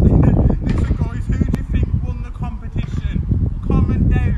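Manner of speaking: loud giddy. Real, not acted.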